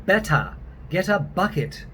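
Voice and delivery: British English with an open A ending on Better; British accent. Shown as a demonstration. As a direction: demonstrate the British dropped R. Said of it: "almost becomes an open A".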